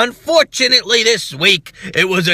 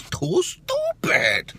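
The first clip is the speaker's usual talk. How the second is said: drawn-out mock disgust; 10 semitones higher, 7 dB quieter, much slower than usual.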